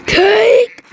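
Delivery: loud high callout; thrilled game shout; excited shout. Real, not acted. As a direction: yell out the find in delight.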